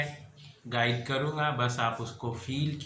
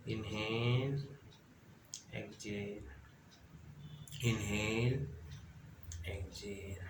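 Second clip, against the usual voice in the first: pace slow breaths, fading softer toward a near whisper; breathing cues; 2 semitones lower, 5 dB quieter, slower than usual. Acted. Guide leading listeners through inhale and exhale, hushed breathing cues.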